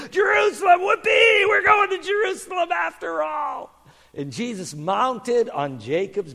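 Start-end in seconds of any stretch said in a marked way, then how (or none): 0.1-0.8 very high, loud, thrilled cry; overjoyed shout. Acted. Young donkey realises his wish is granted, overjoyed. 0.8-3.7 put-on high-pitched, loud, elated child; overjoyed whoop. Young donkey learns he gets to go, overjoyed.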